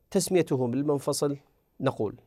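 usual speaking voice

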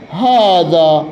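very slow, drawn-out example phrase; slow dictation. Shown as a demonstration. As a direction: stretch each word out for students to follow. Slow dictated grammar example with ending.

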